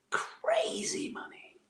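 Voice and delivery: slow, drawn-out whisper; awed whisper. Real, not acted. Actor recalling his first TV show pay, awed whisper. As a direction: stretch it out in a marvelling whisper.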